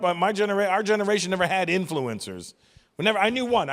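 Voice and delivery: normal talking voice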